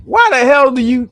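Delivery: usual speaking voice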